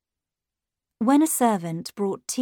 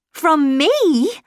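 shocked question, higher and louder; gasping; 8 semitones higher, 8 dB louder than usual. A narrator's reading. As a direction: gasp it out in disbelief. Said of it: "I gasped".

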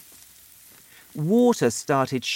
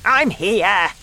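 loud, high answering call; shouting; 7 semitones higher, 7 dB louder than usual. A narrator's reading. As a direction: shout back so you can be found. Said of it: "Grandma shouted".

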